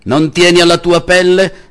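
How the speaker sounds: high, loud menacing roar; a tyrant roaring at the friar. A narrator's reading. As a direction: roar it as a threat. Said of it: "ruggì il messere".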